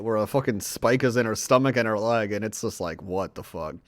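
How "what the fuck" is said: ordinary talk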